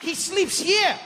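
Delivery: high, sing-song guide patter; cheerful tour guide. Retold or imitated. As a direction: sell it like an over-eager tour guide. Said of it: "he's like".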